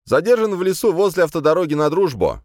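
high, loud official report; eager escort reporting in; brisk report. A narrator's reading. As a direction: report briskly to a superior, pitch way up. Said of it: "бодро отрапортовал мой конвоир".

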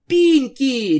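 loud high bark of a name; angry shout of Pinkie; barking her name. A narrator's reading. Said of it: "рявкнула Твайлайт".

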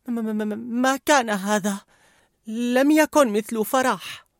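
stammering high nervous voice; nervous Fear. A narrator's reading. Fear stammering nervously to Mother's emotions. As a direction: stutter it out, high and slow. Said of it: "سأل خوف".

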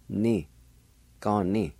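ordinary talk

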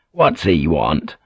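low, slow and sour under the breath; sour mutter. A narrator's reading. Blackthorn grumbles about the arriving priest, sour mutter. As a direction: grumble it at the window, sourly. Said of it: "Blackthorn muttered sourly".

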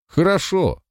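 high-pitched exclamation; calling out. A narrator's reading. Man with the club gladly giving in, calling out. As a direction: blurt it out, pitch jumping up. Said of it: "выкрикнул он".

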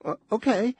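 high, squeaky and faltering; a nervous squeaky okay. A narrator's reading. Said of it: "a squeaky".

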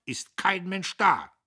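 laut und hoch, langsam hinausgeschrien; geschrien. A narrator's reading. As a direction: mit voller Wut in den Raum rufen. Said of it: "schrie er noch auf der Treppe". (der Major schreit beim Gehen über den leeren Tisch)

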